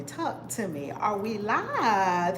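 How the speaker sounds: ordinary talk, nothing marked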